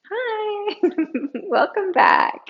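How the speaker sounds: high, giggly greeting; playful laughing welcome. Real, not acted. Teacher welcoming listeners back after the pause, giggling. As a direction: greet them brightly, breaking into giggles.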